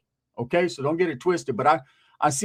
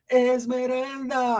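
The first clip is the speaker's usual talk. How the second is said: swooning over the name; 7 semitones higher, much slower than usual. Retold or imitated. Grandma swooning over novela star.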